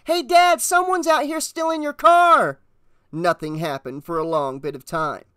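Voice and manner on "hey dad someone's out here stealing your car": put-on high, loud boy's alarm; urgent yell, pitched way up; shouting. A narrator's reading. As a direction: raise the alarm to your father indoors. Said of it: "he shouted through the screen door".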